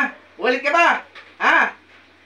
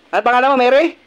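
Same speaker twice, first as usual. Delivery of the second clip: loud, raised demand for an answer; stern interrogation; 5 semitones higher, 6 dB louder than usual. Real, not acted. Exorcist pressing the spirit for its name, loud and stern. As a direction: question with forceful authority.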